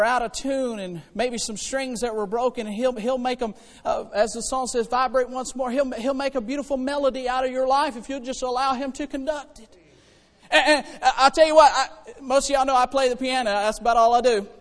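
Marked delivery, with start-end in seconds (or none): none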